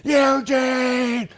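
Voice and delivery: high, strained yell with drawn-out name; angry screaming. Retold or imitated. Wrestler furiously calling Eugene out, imitated yell. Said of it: "he's screaming".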